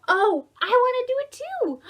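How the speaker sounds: high, loud squeaky mouse; eager mouse. Acted. Storyteller voicing the eager mouse, high and loud. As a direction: squeal it like an excited little mouse.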